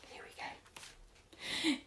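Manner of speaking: breathy whispered lead-in. Acted. Whispering to listeners as the chapter begins. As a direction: lean in and whisper it. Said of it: "whispered lead-in to the story".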